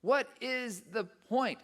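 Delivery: slow, high, word-by-word despairing question; discouraged. Retold or imitated. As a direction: spell out each word in exasperation. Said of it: "I got so discouraged".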